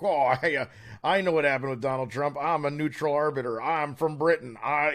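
hammed-up mock British accent; bad British accent. A guest mimicking Brits who claim to be neutral, bad British accent. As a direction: put on a broad, badly faked British voice. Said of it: "that's my best British accent".

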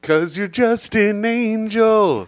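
high, slow angel voice. Pretend. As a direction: speak like a lofty heavenly angel. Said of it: "now do one of the angels".

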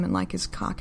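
ordinary delivery, nothing marked

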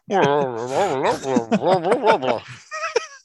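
wordless jowly burbling impression; Mitch McConnell. Shown as a demonstration. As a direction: imitate the senator with a wobbling run of babble. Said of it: "sound like, uh, Mitch McConnell".

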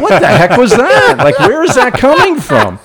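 put-on high, loud baby voice. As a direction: squeal it like a little kid. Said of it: "he has an inner little baby voice".

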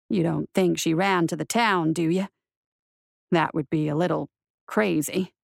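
low, dry marshal voice; wry marshal. A narrator's reading. Marshal confides in his horse after waking, wry.

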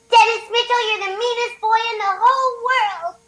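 put-on high, loud little girl voice; whiny cartoon girl scolding; Margaret voice. Shown as a demonstration. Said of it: "I can do Margaret".

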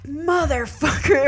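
mock exasperated anger, stretching the word; mad. Fiance seeing the shitty weed, imitated slow anger. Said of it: "so mad".

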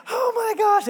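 high, loud amazed cry. Retold or imitated. Daughter seeing her Barbie Jeep, high amazed cry. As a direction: squeal it with disbelief.

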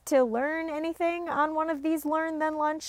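rally the viewers cheerfully; upbeat sign-off. Real, not acted.

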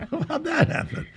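high, laughing disbelief. Real, not acted. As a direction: laugh it out, amused at the phone. Laughing at the interruption.

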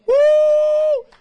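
loud high-pitched whoop. Real, not acted. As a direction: let out a loud joyful whoop. Preacher exclaims.

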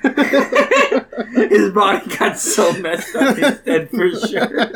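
slow, giggling through the words; laughing. Acted. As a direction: reread while cracking up. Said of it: "I want that to be my eulogy".

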